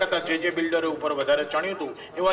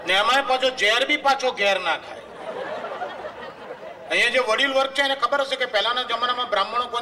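laughing punchline; 8 semitones higher than usual. Real, not acted. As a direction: crow the punchline while laughing.

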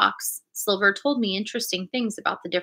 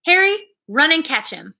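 calling out; 6 semitones higher than usual. A narrator's reading. Silver orders a man after runaway.

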